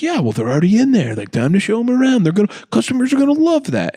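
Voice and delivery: high, fast, breezy boardroom voice; mock executive. Executive pitching an upsell, in a mock high, breezy voice. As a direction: sell the bad idea airily.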